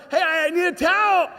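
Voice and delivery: high, loud holler; yelling. Retold or imitated. Boy at the bathroom door wanting a towel, yelling. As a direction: call out loudly through the house. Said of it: "the bathroom door and said".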